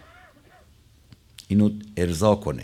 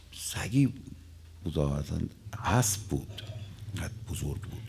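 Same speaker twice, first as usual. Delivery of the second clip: whispered start then softer, playful exaggeration; hushed aside; 4 semitones lower, 8 dB quieter than usual. Real, not acted. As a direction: lower the voice for a joking aside. Hushed exaggerated aside about dog.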